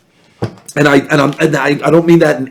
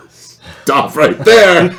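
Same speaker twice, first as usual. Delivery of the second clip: slow, laughing stop right there; amused, drawn-out interruption; mock stop; 7 semitones higher, 4 dB louder, much slower than usual. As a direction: cut him off, cracking up. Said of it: "I'm like".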